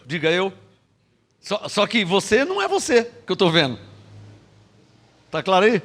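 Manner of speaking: normal talking voice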